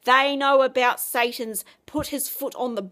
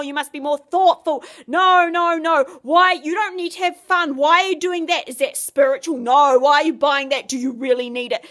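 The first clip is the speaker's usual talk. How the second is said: louder, pushy, rising to shouts; bossy nagging voice; 5 dB louder than usual. Retold or imitated. Devil nagging a believer, bossy and louder. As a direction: hammer them with demands like a tyrant. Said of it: "That bossy voice".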